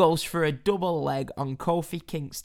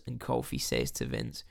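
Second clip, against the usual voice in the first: usual speaking voice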